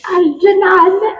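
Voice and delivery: put-on slow, high Caribbean accent. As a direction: draw out the name in a Caribbean accent. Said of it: "in a Caribbean accent".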